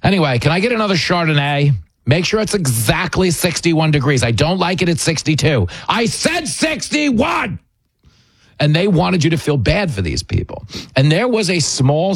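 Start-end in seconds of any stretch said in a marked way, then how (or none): 0.0-7.6 mock royal diva demanding her Chardonnay; spoiled diva. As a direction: play the pampered diva losing patience over wine temperature. Mock spoiled Meghan ordering wine.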